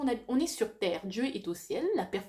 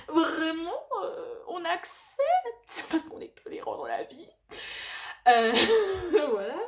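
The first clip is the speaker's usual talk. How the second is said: laughing; 8 semitones higher, 7 dB louder than usual. Real, not acted. Laughing through her own point.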